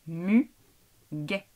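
syllable-by-syllable robot reading. Shown as a demonstration. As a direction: split Muguet like a robot. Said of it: "Je le coupe en syllabes écrites à l'oral".

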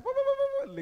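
high, loud siren noise made with the voice; imitated siren. Driver blaring his siren through traffic, mimicked siren. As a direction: sound like a van's siren. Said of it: "that guy was pressing, like".

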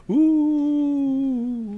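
high, long eerie "ooh"; atmospheric ooh. Pretend. Host adding atmosphere to a forbidden island, eerie ooh. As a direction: draw out a high mock-spooky ooh. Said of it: "Got a little atmosphere".

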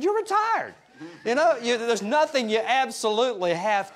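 high mock-incredulous retort. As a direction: pitch it way up in disbelief. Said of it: "I said".

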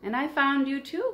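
high, loud squeal of happy relief; joyful cry. Acted. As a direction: call back overjoyed. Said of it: "cried the mouse".

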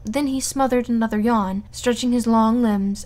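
ordinary delivery

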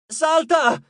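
high, loud command; sudden urgent shout; urgent cry. A narrator's reading. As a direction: call out to jump now. Urgent order to jump.

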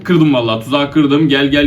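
ordinary talk, nothing marked

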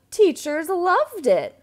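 breathless storybook joy; delighted gasp. A narrator's reading. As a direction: gasp with delight before the words.

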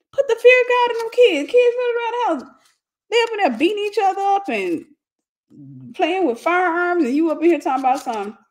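hammed-up panicked parent; freaking out. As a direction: rant high like you're losing it.

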